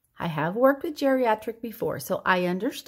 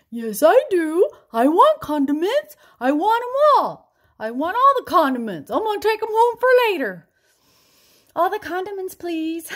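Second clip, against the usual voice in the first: put-on much higher, louder old father demanding condiments; greedy old dad; 9 semitones higher, 7 dB louder than usual. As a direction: grab every condiment like the dad. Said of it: "And then my dad says".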